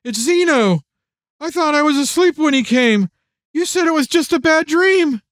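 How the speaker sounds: put-on high, small child's voice, just woken from a nightmare; a frightened young daughter telling her dad about the dream; scared little girl. A narrator's reading. Little daughter Emily to her father, scared child voice.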